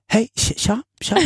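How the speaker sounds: high, strained hello; imitating a tense friend at the door; forced nervous greeting. Tense friend opening the door with a high strained greeting.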